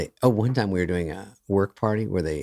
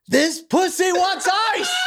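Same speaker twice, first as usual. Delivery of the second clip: high, slow, loud yell to the whole room; a drawn-out high shout; mock shout; 4 dB louder, much slower than usual. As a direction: bellow it across the room. Brother yells to the room.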